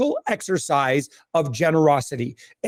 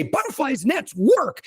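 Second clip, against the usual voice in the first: higher, slow, emphatic assurance; confident pitch; 7 semitones higher, slower than usual. Retold or imitated. Advisers handing you a butterfly net, confident pitch. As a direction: imitate a tactic pusher's slow, high insistence. Voicing people who push tactics.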